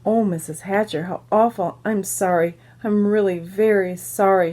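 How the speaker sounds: plain reading voice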